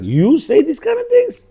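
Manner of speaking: high-pitched disbelieving question; shocked. Acted. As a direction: ask it as if stunned by what you heard. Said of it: "they'd be shocked".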